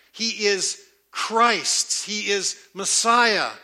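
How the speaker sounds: each title weighed slowly; slow emphatic proclamation. Acted. Preacher declaring Jesus's titles to the church, slow and weighty.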